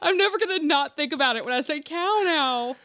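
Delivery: "NEVER" stressed through laughter; laughing. Real, not acted.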